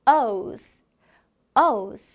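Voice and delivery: O's said slowly, clearly; phonics demo. Shown as a demonstration. Teacher demonstrating O's for children to copy. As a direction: model O's for kids. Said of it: "따라해보겠습니다".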